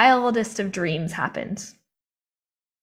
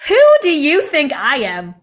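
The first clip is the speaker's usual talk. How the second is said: loud, high disbelief, stretched out; incredulous outburst; 4 semitones higher, 4 dB louder than usual. Retold or imitated. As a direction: scoff at the idea, louder and higher. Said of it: "I was like".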